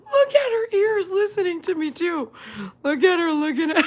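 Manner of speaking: high, squeaky voice through laughter; helpless laughter. Real, not acted. Owner watching the dog's ears listen, cracking up. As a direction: squeeze the words out before the laugh bursts.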